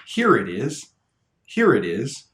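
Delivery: "here it is" with the T of "it" tapped into "is"; flap T linking. Shown as a demonstration. Teacher's example, "here it is" linked by flap T. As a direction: link "it is" in "here it is" like "idiz". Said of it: "find the flap T at the end of the word".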